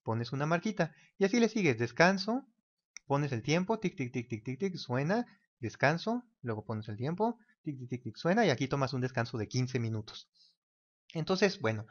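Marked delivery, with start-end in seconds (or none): 3.8-4.8 string of light clock ticks; evenly spoken mock ticking; imitated timer ticking. Imitates timer running again. 7.6-8.1 soft, brief burst of ticks; hushed mock tick-tick; imitated timer ticking. Imitates timer running again.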